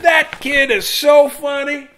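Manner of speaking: high, loud, slow amazed voice; delighted crowd. Acted. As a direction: gush it like amused grown-ups. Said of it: "They said".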